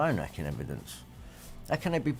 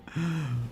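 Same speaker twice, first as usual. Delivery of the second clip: mock sigh; 6 semitones higher, 4 dB quieter than usual. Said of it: "based on, "Feel sorry for me".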